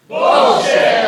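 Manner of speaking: loud, high shouted Bullshit; group shout. Real, not acted. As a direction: shout it out together, full voice. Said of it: "One more time".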